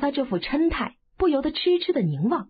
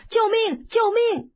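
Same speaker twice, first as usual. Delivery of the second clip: high-pitched repeated yell; loud cry for help; 8 semitones higher than usual. A narrator's reading. Boy clinging to the girl, yelling for help. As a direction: cry for help in a raised, higher voice. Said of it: "跟着便大叫".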